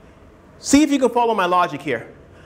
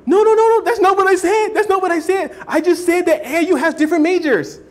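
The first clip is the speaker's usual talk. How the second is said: panicked denial; 9 semitones higher than usual. Retold or imitated. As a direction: shout the denial in a high rush. Acted denial in dialogue.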